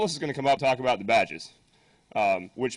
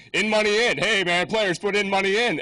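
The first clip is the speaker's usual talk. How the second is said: higher, louder teasing of "players put in money in", leaning on "money" and the final "in"; mocking hecklers; 7 semitones higher, 4 dB louder than usual. Conference crowd teasing the flyer typo, loud mocking voice. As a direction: rib the speaker about "players put in money in". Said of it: "everyone kept saying".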